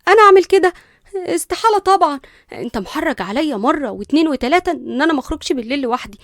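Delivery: high, loud indignant denial; fake innocence. A narrator's reading. Cousin denying she sneaked out, falsely innocent. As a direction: protest innocence too eagerly. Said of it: "ببراءة مصطنعة".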